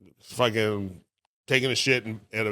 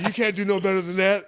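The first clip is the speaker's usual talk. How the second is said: high-pitched mock protest; retold indignant challenge; 9 semitones higher than usual. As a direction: imitate an affronted friend, pitched way up. Friend's challenge in retold quarrel.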